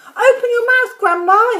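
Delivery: loud, high call; urgent cry. A narrator's reading. George running back with water, urgent cry. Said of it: "he cried".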